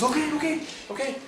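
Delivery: high, quick okays from the boat driver; excited hurry. Retold or imitated. Ron answering "I got one.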